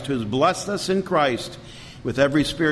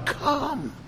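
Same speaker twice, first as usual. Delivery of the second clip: "come" high but softer, drawn in; "come" as a gentle, hushed invitation; soft call; 10 semitones higher, 6 dB quieter than usual. Acted. Prophet's call repeated softly.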